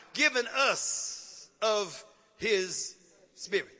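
very slow, spaced-out scripture reading; deliberate reading, dropping low on the last word; word-by-word emphasis. Real, not acted. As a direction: stretch each word and pause between them.

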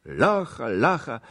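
repeated loud, high chant-like call. A narrator's reading. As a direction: call for the prisoner as one of the mob. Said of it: "Jedni wołali". (głośne, powtarzane wołanie)

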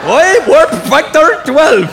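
high, loud, drawn-out Scottish cry; Scotty. Acted. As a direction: stretch it out as a frantic, garbled Scottish engineer. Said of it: "Scotty in Star Trek".

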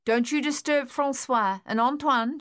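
calling after. A narrator's reading. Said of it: "she called after the dog".

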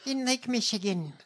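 high, put-on politician voice; mimicking the candidate. Host parodies the candidate's constituent story, high mocking voice. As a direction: pitch it up and parody her anecdote. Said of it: "and then they went to her and she said".

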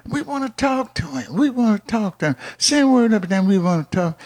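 high, urgent begging to be heard; acted-out pestering, high; insistent pleading. Voices the family pressing for access.

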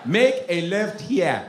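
put-on slow, commanding Nigerian accent; African GPS. Nigerian GPS giving directions, slow Nigerian accent.

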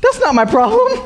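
high, whiny dismissive brush-off; bratty attitude. Retold or imitated. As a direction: push it away like a sulky teenager. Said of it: "giving a little bit of attitude".

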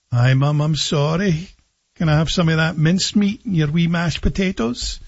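put-on sheepish Scottish child; Scottish accent. Hungry boy says sorry to Mum, sheepish Scottish accent. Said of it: "I come skulking out".

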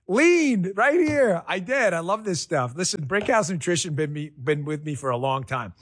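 ordinary talk

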